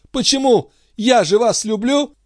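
very high, impassioned voice; impassioned outburst. A narrator's reading. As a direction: fling it out, pitched high. Count's words echoed, queen bursts out. (страстный высокий возглас)

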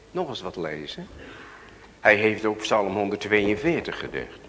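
normal talking voice

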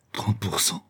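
breathless stunned whisper. A narrator's reading. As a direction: whisper it in awe. Stunned at thirty percent gold.

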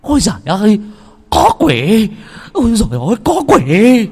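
high, loud panicked shrieks; horror-struck cries at seeing a demon; terrified screaming. Acted. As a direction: scream for your life. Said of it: "la hét".

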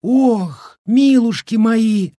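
high, drawn-out peasant woman's lament; gasped dismay. A narrator's reading. Village woman seeing the strange meat, aghast. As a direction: wail it in shocked dismay. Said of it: "ахнула одна из баб".

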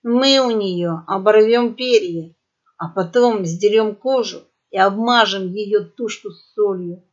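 ordinary delivery

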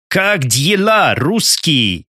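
put-on slow, high, German-accented broken Russian; German soldier mangling Russian words. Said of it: "коверкая русские слова".

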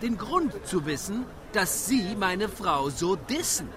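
normal talking voice

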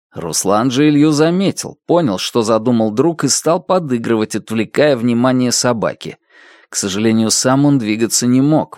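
plain reading voice

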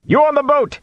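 very high hailing shout. A narrator's reading. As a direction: call out urgently to a crew. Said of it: "he shouted".

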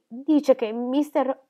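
ordinary delivery, nothing marked

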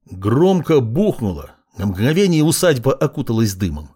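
ordinary delivery, nothing marked

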